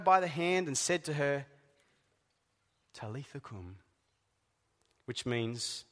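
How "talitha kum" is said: hushed, gentle call to wake; soft command. Retold or imitated. Jesus gently telling the dead girl to rise, hushed. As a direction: murmur it softly to the child.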